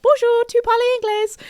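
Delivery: high-pitched, badly accented tourist French; squeaky bad French greeting; terrible French accent. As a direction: attempt French with a hopelessly English accent. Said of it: "that is the worst accent ever".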